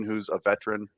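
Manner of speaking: usual speaking voice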